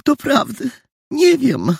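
high, helpless woman's voice; fretful high lament; sighing landlady. A narrator's reading. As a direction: sigh that you have no idea, pitched high. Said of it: "gospodyni westchnęła".

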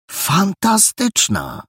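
high, slow, delighted cry; excited exclamation. A narrator's reading. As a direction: call it out in amazement. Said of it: "zawołał Perełka".

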